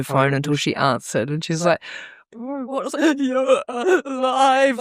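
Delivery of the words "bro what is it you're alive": high, wobbly mock crying with laughter; hammed-up tearful wail, drawn out; imitating bawling. Retelling a relieved call after a nightmare, mock bawling. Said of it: "Like, just fucking bawling my eyes out".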